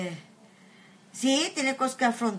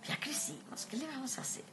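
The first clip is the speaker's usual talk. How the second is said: resigned whisper; 17 dB quieter than usual. Real, not acted. Resigned aside about getting older.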